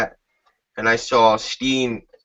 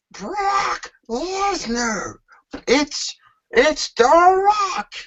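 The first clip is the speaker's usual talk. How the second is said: much higher, slow and stretched announcer cry; Michael Cole impression; much slower than usual. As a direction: drag out each name like a stunned commentator. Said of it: "hear Michael Cole go".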